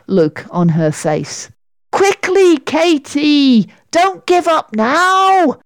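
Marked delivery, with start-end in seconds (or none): 1.9-5.6 high, urgent calling; urging on. A narrator's reading. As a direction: spur a flagging friend to keep going. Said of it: "Sarah tried to urge Katy on".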